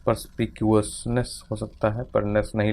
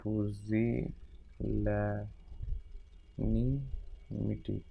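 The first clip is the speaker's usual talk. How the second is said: indefatigability softly stretched out syllable by syllable; murmured spelling out; 10 dB quieter, much slower than usual. Shown as a demonstration. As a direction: slowly murmur indefatigability while writing. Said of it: "murmured slow while writing".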